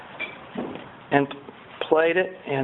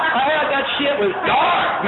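emphatic shout; 8 semitones higher than usual. Real, not acted. Dark room story, emphatic.

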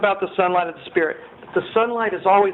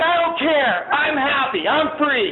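giddy joy; 8 semitones higher than usual. Retold or imitated. Joyful reply to woken friend.

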